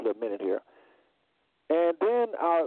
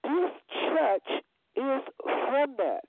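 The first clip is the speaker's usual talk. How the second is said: high, slow, weighty quote; retold solemn revelation; 8 semitones higher, slower than usual. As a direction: retell the driver's revelation, drawn out and pitched up. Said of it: "He said".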